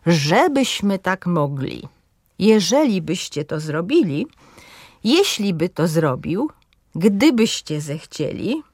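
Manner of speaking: weight before the endings in "żebyśmy", "jeśli by", "gdybyście"; stress third from end. Shown as a demonstration. Teacher giving example conjunction phrases, careful antepenultimate stress. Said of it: "akcent pada na trzecią sylabę".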